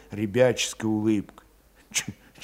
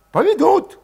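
put-on high, agitated young cossack voice; Valet voiced high; 7 dB louder than usual. A narrator's reading. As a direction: push it up high and restless.

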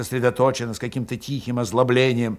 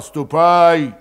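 menacing threat; 5 semitones higher, 8 dB louder, slower than usual. A narrator's reading. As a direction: promise harm. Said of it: "недобрым голосом пообещал".

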